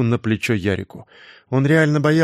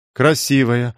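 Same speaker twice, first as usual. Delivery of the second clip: plain reading voice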